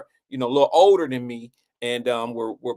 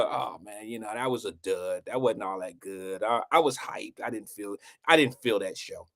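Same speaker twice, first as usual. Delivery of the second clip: dejected; 8 semitones lower, 6 dB quieter than usual. Retold or imitated. Imitates himself after a dud show.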